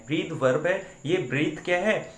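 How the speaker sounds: ordinary talk, nothing marked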